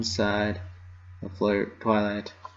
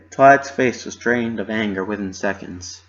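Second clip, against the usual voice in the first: ordinary delivery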